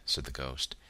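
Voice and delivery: ordinary delivery, nothing marked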